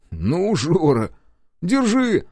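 slow then high loud hand-over; grudging offer. A narrator's reading. Паша нехотя делится бутербродом с Жорой. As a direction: give up half the sandwich with a show.

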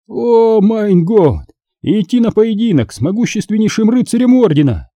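high-pitched amazed exclamation; astonished magister. Acted. Magister hearing the boy is eighteen, astonished. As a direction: react in disbelief, voice leaping up.

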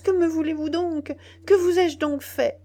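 lamentable cry. A narrator's reading. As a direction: plead desperately for his life. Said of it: "en criant d'une voix lamentable".

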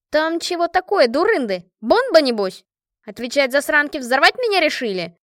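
loud, high interrogation; alarmed demand. A narrator's reading. Машка suspects her friends' gift is a bomb, indignant. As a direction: demand answers in rough indignation.

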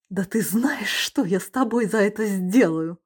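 threatening anger. Acted. Furious woman threatens.

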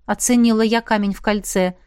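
usual speaking voice

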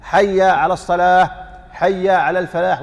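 حي said with fatha, slow chant; correct fatha. Shown as a demonstration. Teacher modeling the correct adhan phrase. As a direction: open حي with a fatha. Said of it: "حي بفتح".

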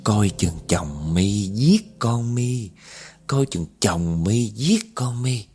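slow, drawn-out ghostly warning; stretched spectral refrain; haunting woman's voice. Retold or imitated. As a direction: repeat it like an eerie chant. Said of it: "cái tiếng của người phụ nữ đó lặp đi lặp lại".

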